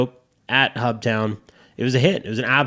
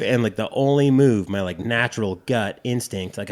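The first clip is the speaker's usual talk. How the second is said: ordinary delivery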